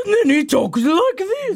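high put-on courtier; mock a courtier in a silly high voice; exaggerated high-pitched impression; courtier's voice. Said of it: "to hear a courtier's voice".

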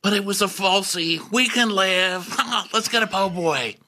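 high, breezy voice; breezy Shep. Retold or imitated. Shep moving on to lunch, high breezy. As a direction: shrug it off cheerfully. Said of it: "voicing Shep".

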